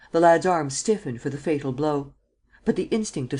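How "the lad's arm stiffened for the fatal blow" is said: ordinary delivery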